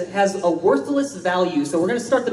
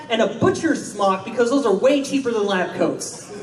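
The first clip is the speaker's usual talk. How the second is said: ordinary talk, nothing marked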